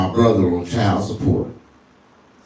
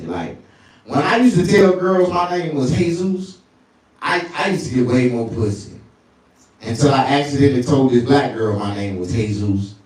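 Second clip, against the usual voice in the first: ordinary talk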